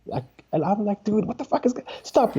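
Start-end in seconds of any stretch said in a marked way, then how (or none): 1.0-2.4 high-pitched exasperated plea; retold argument. Comic interrupting a fighting couple, exasperated. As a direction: break in urgently, pitch shooting up. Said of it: "shouting at each other".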